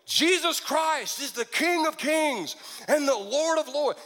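high, slow, grand declaration; bold proclamation. Acted. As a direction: proclaim it like a mock herald, pitch soaring. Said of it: "He didn't stand up and go".

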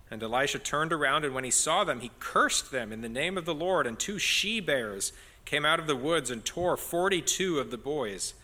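loud, projected Bible reading; scripture reading. A narrator's reading. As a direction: read the passage out strongly to the congregation. Reads the Bible passage aloud.

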